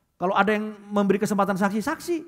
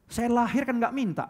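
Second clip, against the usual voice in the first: lightly voiced cheeky child, higher; bratty child; 7 semitones higher than usual. Preacher mimics an ungrateful child, light mock higher voice. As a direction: toss it off like a kid who owes nothing.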